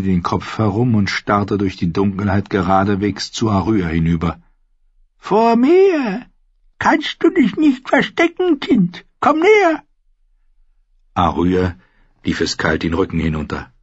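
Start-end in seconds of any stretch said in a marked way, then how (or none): 5.2-9.8 put-on high, uncanny old woman calling; high crone beckoning the child; eerie crone summons. A narrator's reading. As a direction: lure the child out, eerily. Seeress calls the hidden child.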